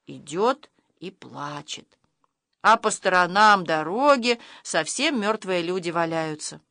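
usual speaking voice

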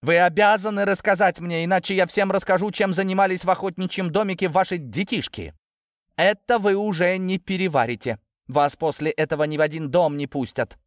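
high, insistent threat; threatening. A narrator's reading. Natasha threatening to expose the sons, threatening. Daughter blackmailing parents.

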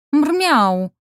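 high, loud angry mrrow; indignant cat yowl. A narrator's reading. Cat yowling at the grabbing student, indignant. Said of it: "возмутилась я".

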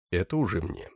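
ordinary delivery, nothing marked

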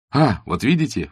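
a light, lively exclaim; mild exclamation. A narrator's reading. Arkady catching Katya's admission, mildly exclaiming. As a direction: catch her out with a small triumphant cry. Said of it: "воскликнул Аркадий".

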